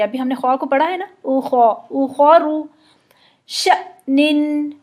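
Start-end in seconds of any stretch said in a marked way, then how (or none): 1.3-2.6 उखौरु with a thick rounded खौ, slow; full heavy kha. Shown as a demonstration. Teacher drilling heavy kha, slow. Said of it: "खौ को पुर करेंगे". 3.5-4.7 शनिन stopped with a jolt after शa, very slow; sukun hamza jolt. Shown as a demonstration. Teacher demonstrating silent hamza, slow with a jolt. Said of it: "एक झटके के साथ पिछली हरकत को रोक देता है".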